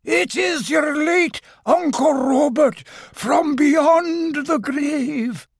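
very high, slow wailing voice; haunt him with long stretched vowels; ghostly. A narrator's reading. Fake uncle's ghost warning Owl off, ghostly. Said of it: "a ghostly voice".

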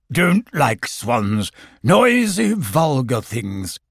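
grumpy, slow Owl; cross voice from within. Acted. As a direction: snub them from behind the door. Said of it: "cross voice from within".

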